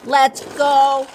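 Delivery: loud eager robot shout. Acted. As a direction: shout it and take off. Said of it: "Robo Pete shouts".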